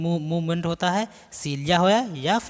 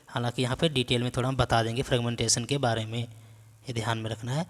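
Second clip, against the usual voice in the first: ordinary delivery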